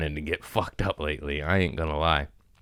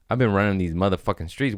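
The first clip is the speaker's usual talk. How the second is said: ordinary talk, nothing marked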